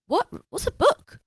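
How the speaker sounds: squeaky high bafflement; mock confusion. A Roman puzzled by the word book, squeaky high.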